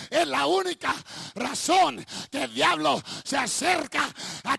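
loud, high preacher's cry; preaching shout. Acted. Preacher calling the church toward God's voice, shouting. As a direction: preach it out at full force. Preacher building to full force.

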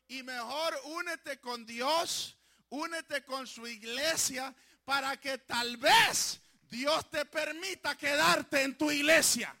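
slow, building into a loud preaching shout; preaching at full force. Real, not acted. Pastor warning his flock to stay, rising to a shout. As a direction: swell from a firm plea to a loud shout.

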